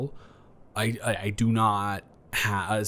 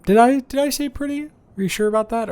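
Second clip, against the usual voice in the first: much higher and louder, caught out; high, louder panicked backpedal; flustered denial; 13 semitones higher, 6 dB louder than usual. Acted. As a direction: squeak it like you've been caught. Caught calling guest pretty, flustered.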